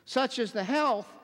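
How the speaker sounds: emphatic preacher's reading. Real, not acted. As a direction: read it out as damning evidence. Reads out with preaching force.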